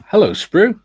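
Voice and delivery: normal talking voice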